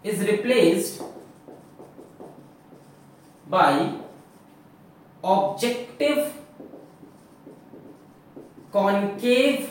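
slow dictation with long pauses between words; spaced-out dictation for note taking. Shown as a demonstration. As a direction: read it out word by word so they can write. Teacher dictating notes.

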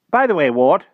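the magician breaks off; wizard voice. A narrator's reading. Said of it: "said the magician".

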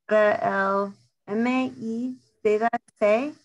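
careful, halting Spanish read aloud. Shown as a demonstration. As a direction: read the question slowly in Spanish. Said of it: "I'm going to try to read it in Spanish".

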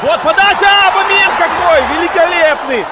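sudden high, loud excitement; excited call. Real, not acted. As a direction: burst out at the big chance. Commentator excited at chance. (возбуждённый выкрик)

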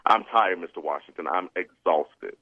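put-on sag into it like a worn-out kid; imitating a tired child. Boy admitting exhaustion to Mister Washington, weary and low. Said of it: "'cause really, I'm just tired".

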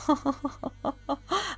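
soft run of giggles; laughter. Real, not acted. Player chasing a fleeing suspect, quiet giggling laugh. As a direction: chuckle along quietly mid-chase.

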